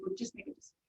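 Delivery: high, hushed mock complaint; imitated frustration. Official quoting fed-up people on the back-and-forth, mildly frustrated imitation. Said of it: "that has frustrated people because they're like".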